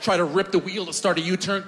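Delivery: ordinary talk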